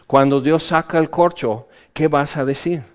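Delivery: ordinary talk, nothing marked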